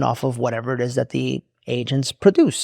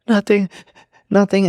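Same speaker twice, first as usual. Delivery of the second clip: higher, mock-tearful voice; imitated tearful denial; 6 semitones higher than usual. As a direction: play someone crying and hiding it. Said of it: "she's doing one of those cries".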